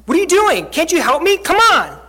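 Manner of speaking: loud, high exasperated demand; angry outburst. Acted. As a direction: act out someone fed up and demanding help. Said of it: "She's angry".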